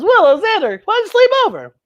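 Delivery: put-on high, eager little-girl invitation. Young Buffy inviting her friends over, high and eager. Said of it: "She says".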